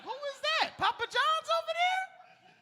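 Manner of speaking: startled falsetto. Retold or imitated. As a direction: squeak like a baffled guy next door. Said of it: "I be like".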